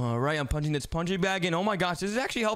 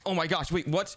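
usual speaking voice

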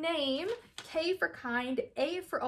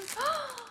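high quick gasp; delighted gasp; 13 semitones higher, 5 dB quieter than usual. Real, not acted. Vlogger finding the baggies she uses, a delighted gasp. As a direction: gasp at the find.

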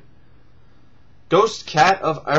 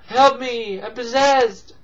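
lightly raised pleading voice; mock possessed plea; 8 semitones higher, 3 dB louder than usual. Imagining the possessed movie character, lightly mock pleading. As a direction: plead half-heartedly for help. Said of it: "So it could be like".

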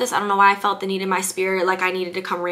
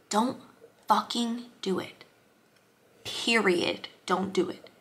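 softer, leaning in to share a secret; confiding hush; 8 dB quieter, slower than usual. Shown as a demonstration. Telling the person in the back, in a hushed aside. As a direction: drop to a near-hush for the back row. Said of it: "come a little closer".

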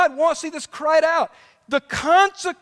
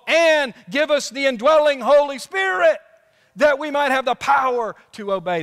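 preaching at full force; 4 semitones higher, 3 dB louder than usual. Acted. Preacher builds to climax.

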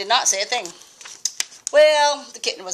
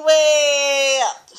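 ordinary delivery, nothing marked

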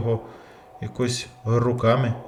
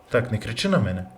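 ordinary talk

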